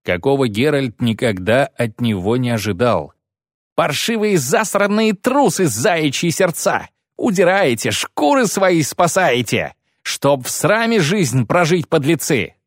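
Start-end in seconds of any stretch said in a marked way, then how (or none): 3.7-12.5 barked tirade. A narrator's reading. Lyutik shames soldiers running to save their skins, barking. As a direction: hurl abuse at deserters, high then easing down. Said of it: "рявкнул ... таким голосом".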